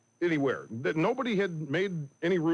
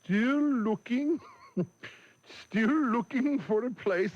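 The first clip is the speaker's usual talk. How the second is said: higher, slow Costa Rican waiter voice; 5 semitones higher, slower than usual. Retold or imitated. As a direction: draw it out like a lost waiter. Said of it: "started saying".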